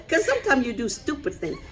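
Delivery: ordinary delivery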